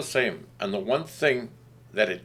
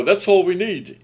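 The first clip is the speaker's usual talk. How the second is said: higher, louder, drawn-out quote of keen adopters; mock enthusiast; 8 semitones higher, 7 dB louder than usual. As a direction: imitate a sold company, loud and slow. Quoting companies keen on AI.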